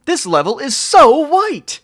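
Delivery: high loud squeal; squealing exclaim. Real, not acted. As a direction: shriek it in fake horror. Mock shock at level look.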